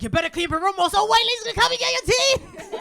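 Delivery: high, loud parental warning, building; mock disciplining threat. Mother threatening son with tooth fairy, mock-menacing shout. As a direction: threaten a kid with a bogeyman. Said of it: "used it as a disciplining method".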